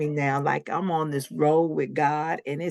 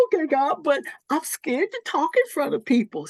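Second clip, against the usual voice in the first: scared plea; 10 semitones higher than usual. Retold or imitated. Confessing fear to God.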